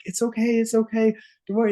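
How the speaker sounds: high gentle soothing; comforting. Retold or imitated. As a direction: reassure a crying child. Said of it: "I was like".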